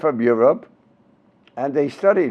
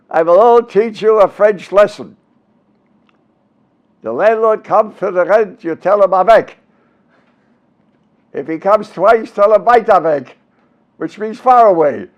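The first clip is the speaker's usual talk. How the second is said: mock French lesson in a raised Yiddish-accented voice; Willie Howard; 5 semitones higher, 5 dB louder than usual. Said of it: "parts of his act with that accent".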